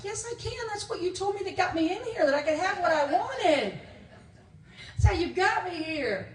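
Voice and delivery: high, loud mock outburst of objection; indignant protest. Student objecting to what the class promised, comic indignant protest. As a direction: argue back in a hammed-up, rising-pitched yell.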